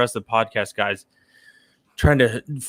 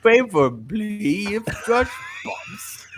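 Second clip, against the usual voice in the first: high, slow mocking echo of "pops"; 11 semitones higher, slower than usual. Teammate mocks the tweet's wording in a high slow echo. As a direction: parrot "Favorably if Josh pops" back high and drawn out.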